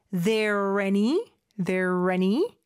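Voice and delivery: "there any" said slowly as one linked word; linked pronunciation. Shown as a demonstration. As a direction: run "there" into "any", drawing it out. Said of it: "여는 되는 부분이 두 군데가 있어요". ("there any"를 천천히 이어서 발음)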